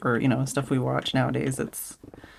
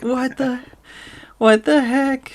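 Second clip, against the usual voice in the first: high, louder stunned exclamation; baffled heck; 12 semitones higher, 4 dB louder, slower than usual. Retold or imitated. Telling a friend about Game Grumps, high stunned outburst. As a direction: squeak out disbelief.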